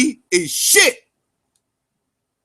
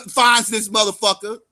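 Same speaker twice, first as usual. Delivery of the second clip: ordinary talk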